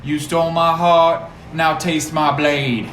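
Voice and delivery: loud heated vow; dramatic shout. Acted. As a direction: yell it as a vengeful hero. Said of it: "And I tell that bitch".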